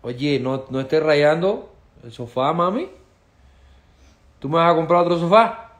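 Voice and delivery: más fuerte y alto, regaño medio en broma; regaña a la mascota por rayar el sofá; regaño a la perrita. Real, not acted. (a la perrita que raya el sofá, regaño juguetón y fuerte)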